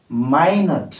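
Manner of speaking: "ମାଇନଟ୍" with a drawn-out "my" syllable; long I minute. Shown as a demonstration. Teacher repeating the other word for learners, "ମାଇନଟ୍" with long "my". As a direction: stretch the first vowel of "ମାଇନଟ୍" like "my". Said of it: "ଯୋଉଟା ହେଉଛି ମାଇନଟ୍".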